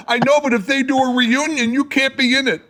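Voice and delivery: high, teary mock sob of a crying sister. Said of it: "and she's crying".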